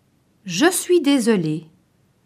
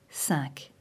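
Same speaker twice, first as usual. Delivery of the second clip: a soft unvoiced whisper; whispered number; 8 semitones lower, 14 dB quieter than usual. Shown as a demonstration. Teacher whispering the item number. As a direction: breathe the number out quietly.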